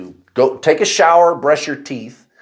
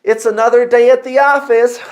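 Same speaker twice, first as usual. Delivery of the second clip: much higher, louder forced cheer with a laugh; fake cheerful; 10 semitones higher, 4 dB louder than usual. Telling worried coworkers he's fine, hammed-up fake cheer. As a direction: put on a brave, chirpy front. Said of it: "And I would go".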